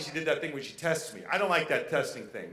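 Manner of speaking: usual speaking voice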